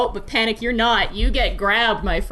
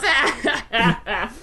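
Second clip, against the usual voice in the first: hearty laugh; 4 semitones higher than usual. Acted. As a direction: burst out in a big acted guffaw. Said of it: "he smacks you on the back and he goes".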